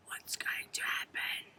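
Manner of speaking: put-on whispered question to the children; suspense whisper. A narrator's reading. Storyteller whispering to children before the danger. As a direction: lean in and whisper it.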